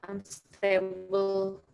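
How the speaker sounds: slow, stretched, garbled glitch; robotic stutter from a dropping connection. Real, not acted. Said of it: "Suaranya putus-putus".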